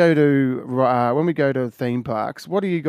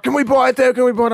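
put-on eager kids; 10 semitones higher, 4 dB louder, faster than usual. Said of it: "biggest smiles on their face and they're like".

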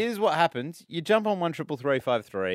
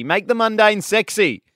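loud and high, drawn out; hyped radio plug; 8 semitones higher, 8 dB louder than usual. Real, not acted. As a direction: sell the tagline big. Radio promo hype.

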